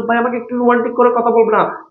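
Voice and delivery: মিষ্টি আদুরে মেয়েলি গলা; sweet coy lady. Pretend. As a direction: play a charming girl begging for romance, lightly. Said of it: "তুমি একদম রূপবতী গুণবতী হয়ে বলছো".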